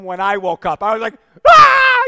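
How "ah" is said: loud, high re-enacted scream; retold startled scream. Parent retelling waking to a child's face, startled scream. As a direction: scream as if jolted awake, acting it out. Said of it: "You know, I screamed".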